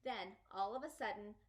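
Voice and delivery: ordinary delivery, nothing marked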